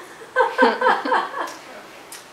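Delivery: high, bursting laugh; laughter. Real, not acted. Presenter laughing after the audiobook joke, high laughter.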